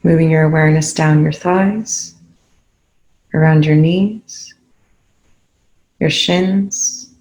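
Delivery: ordinary delivery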